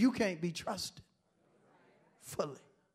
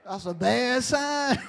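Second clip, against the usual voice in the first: loud rising preacher call; preaching at full force; 5 semitones higher, 10 dB louder, slower than usual. Acted. Preacher calling out to the church, loud. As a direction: hammer the question at the congregation.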